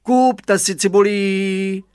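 high, loud, drawn-out hawker's call; market-vendor cry. A narrator's reading. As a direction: imitate a street seller, holding the last vowel. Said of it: "řekl po způsobu trhovců". (táhlé vyvolávání po způsobu trhovců)

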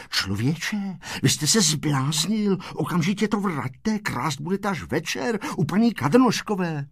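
plain reading voice

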